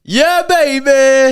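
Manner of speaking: loud, high, drawn-out whoop; elated cheer. Real, not acted. Celebrating a booking just confirmed, loud elated cheer. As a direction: belt it out in triumph.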